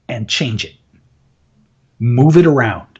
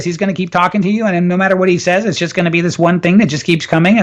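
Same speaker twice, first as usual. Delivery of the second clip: droning talker who never varies the pitch; one-note monotone; faster than usual. Shown as a demonstration. Coach imitating the annoying one-note guy, in a relentless monotone. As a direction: run it all on one unchanging note. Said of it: "it's gonna sound like this".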